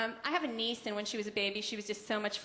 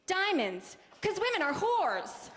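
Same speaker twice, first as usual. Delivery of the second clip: mock ad tagline; 6 semitones higher, slower than usual. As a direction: pitch it high and slow like a commercial.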